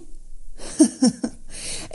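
a light, breathy acted laugh. A narrator's reading. Bride's father laughs off the shy girl's exit, chuckling. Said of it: "أبو فجر ضحك".

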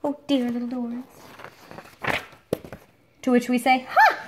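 usual speaking voice

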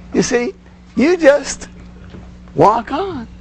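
ordinary delivery

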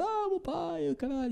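put-on high, slurred drunk lament; drunken wail. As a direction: wail it like a drunk mourning his dad. Drunk lament for his father.

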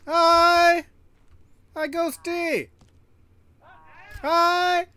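high, loud cheery hi; cheery greeting. Real, not acted. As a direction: sing out a bright hello. Greeting Ghosty.

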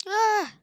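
very high, very loud scream; piercing startled scream. Real, not acted. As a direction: scream in shock. Sudden scream.

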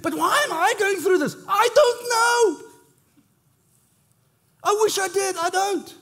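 high, pleading, half-laughing cry of despair, slowing on I don't know; anguished why me. Retold or imitated. Worshipper in the valley crying out to God, high and anguished. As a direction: wail it out, high and stretched, then settle. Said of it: "You sit there and you think".

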